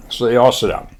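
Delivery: ordinary delivery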